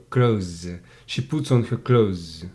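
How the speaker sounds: "clothes" with no th, ending in plain z; spoken-English pronunciation. Shown as a demonstration. Teacher demonstrates spoken English, "clothes" without th. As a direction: say "clothes" like the verb "close". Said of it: "that is spoken English".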